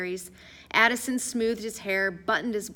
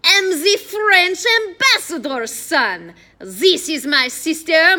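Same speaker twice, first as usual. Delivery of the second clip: loud, slow, high French pomposity; exaggerated French accent; 8 semitones higher, 11 dB louder, slower than usual. A narrator's reading. Addison introducing himself to the guard, pompous French accent. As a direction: proclaim grandly in a hammy French accent. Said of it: "speaking in an exaggerated French accent".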